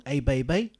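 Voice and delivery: ordinary talk, nothing marked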